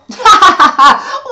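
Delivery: loud, high witch's cackle; evil cackle. Acted. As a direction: cackle with glee. Witch laughs after her speech.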